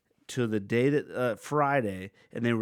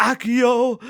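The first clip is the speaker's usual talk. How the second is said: higher, loud wizard incantation; casting a spell; 11 semitones higher, 11 dB louder than usual. Retold or imitated. Playing wizard around the store, calling out a spell. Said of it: "doing spells and stuff".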